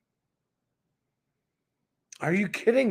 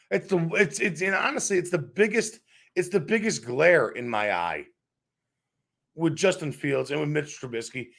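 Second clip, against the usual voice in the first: ordinary talk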